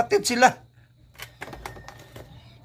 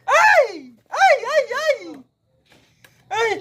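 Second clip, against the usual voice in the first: excited cries; 12 semitones higher, 3 dB louder, slower than usual. Pretend. Toy fight commotion.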